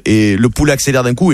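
ordinary delivery

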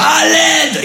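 high mock yell for help; mock cry for help. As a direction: call out for help, hammed-up. Imagined dying person calling for help. (appel au secours exagéré)

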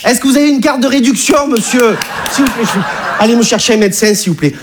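high, officious inspector voice; fussy ticket inspector. Retold or imitated. Ticket inspector asking a fainted passenger for his card, high and officious. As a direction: pester the fainted man like a clueless inspector. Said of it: "en disant".

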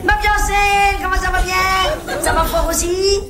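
high, slow sing-song hello; stretched sing-song sociable voice; gushing greeting. Acted. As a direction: draw out the greeting, then search for words. Gushing greeting to another friend.

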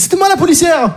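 excited reveal. Pretend. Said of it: "qu'elle dise".

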